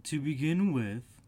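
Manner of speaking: teasing storybook cat; Cheshire Cat. A narrator's reading.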